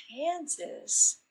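soft, deflated disbelief at the word Kansas; quiet incredulous question; dismayed Kansas?. Pretend. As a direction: let it sink, unimpressed. Acted disappointed reaction.

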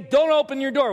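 high, loud warning; stern parental order. Acted. Parent warns child at bedroom door, high angry command. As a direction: lay down the law, acted exasperation.

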